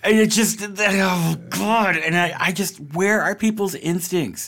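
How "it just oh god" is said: exasperated outburst. Real, not acted.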